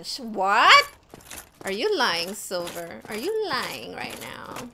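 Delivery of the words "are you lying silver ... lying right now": hushed suspicious whisper. Real, not acted. Streamer whispering suspiciously at Silver in game. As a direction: drop to a whisper, half accusing.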